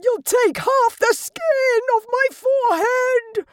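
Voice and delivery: high, frantic shouted pleading; screaming. Acted. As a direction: yell in pain, begging her to let go. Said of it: "he screamed".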